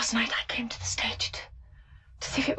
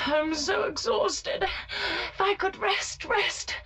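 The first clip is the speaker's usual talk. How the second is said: slow, higher, worn out; exhausted lament; 8 semitones higher, much slower than usual. Acted. Nina breaking down before Treplev, slow and exhausted. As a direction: drag the words out with exhaustion.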